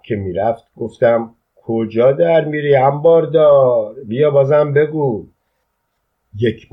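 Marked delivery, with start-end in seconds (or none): 1.6-5.3 raised, higher taunt; taunting challenge. A narrator's reading. Narrator taunts the retreating storekeeper, raised challenging voice. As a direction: call tauntingly after someone backing off. Taunting a man backing away.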